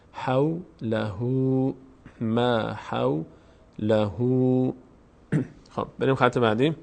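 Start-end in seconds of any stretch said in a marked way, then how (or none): 0.1-4.7 slow "حولهو" with a long final "oo"; lengthened damma. Shown as a demonstration. Teacher reads the whole phrase for learners, drawn-out "oo". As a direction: recite "ما حولهو" slowly, holding the last vowel.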